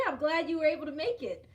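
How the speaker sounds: usual speaking voice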